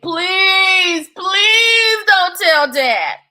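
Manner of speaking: little Theo begging his brothers' secret stays. Acted. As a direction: beg with a touch of a whimper. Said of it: "Theo begged".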